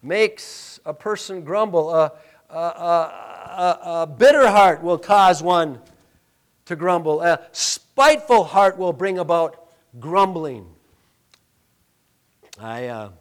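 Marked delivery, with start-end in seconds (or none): 0.0-10.7 loud, ringing pulpit preaching; full-force preaching. Acted. Pastor preaching loudly to his congregation. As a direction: preach it out to the whole church.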